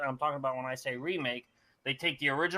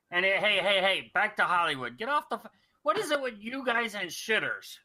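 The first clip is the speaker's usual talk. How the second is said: mock scolding; 7 semitones higher, 4 dB louder than usual. Real, not acted. Scolding co-hosts for dirty talk.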